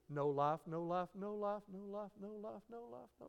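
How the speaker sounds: soft, even chant of no life; hushed repetition. Shown as a demonstration. Preacher acting out a time without life, hushed repetition.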